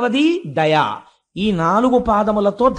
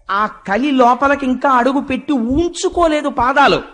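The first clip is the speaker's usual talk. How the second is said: usual speaking voice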